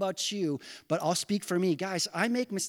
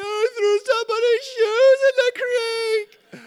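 crying confession; 12 dB louder than usual. Retold or imitated. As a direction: blubber it like a guilty kid. Boy confesses crying to mom.

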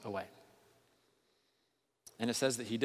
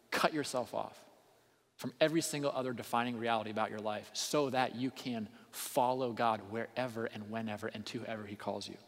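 usual speaking voice

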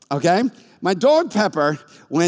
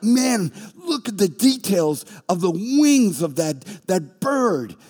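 amazed admiration; 3 semitones higher, slower than usual. Retold or imitated. Friends marvelling at his picture.

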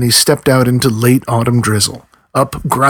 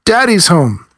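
shouting; 6 semitones higher, slower than usual. A narrator's reading. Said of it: "the children shouted".

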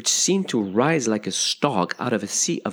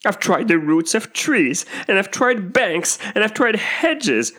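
high, wailing bird voice; put-upon pigeon lament; complaining pigeon; 10 semitones higher, 3 dB louder than usual. A narrator's reading. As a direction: list each failure in high, drawn-out despair. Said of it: "the pigeon went on without attending to her".

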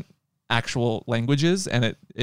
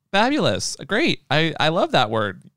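gushing with higher, swooping pitch; mock delight; 3 semitones higher than usual. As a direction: feign being thrilled by the word. Imagined comeback to a taunt.

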